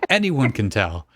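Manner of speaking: ordinary talk, nothing marked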